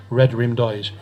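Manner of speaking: ordinary delivery, nothing marked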